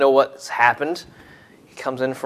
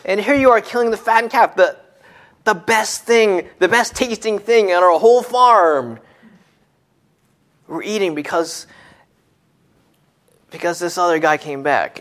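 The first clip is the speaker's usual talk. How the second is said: put-on bitter older son; 6 semitones higher than usual. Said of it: "But he says".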